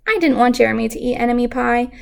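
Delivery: ordinary delivery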